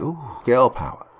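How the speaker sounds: mocking Valley Girl accent, high end; Valley Girl mockery. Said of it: "with the classic Valley Girl accent".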